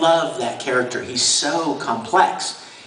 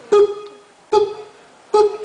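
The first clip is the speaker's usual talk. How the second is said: imitated beeps; slower than usual. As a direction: tap out the cue beeps. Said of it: "while I'm hearing the beeps".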